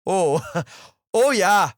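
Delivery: hammed-up Fargo-style Minnesota accent, loud and high; laughing, exaggerated Minnesota accent. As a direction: play a chirpy Minnesota neighbor, vowels stretched. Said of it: "give me a good Minnesota accent".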